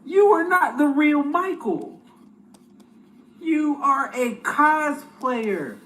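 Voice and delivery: slow scolding. Real, not acted. Scolding the Michael impersonator.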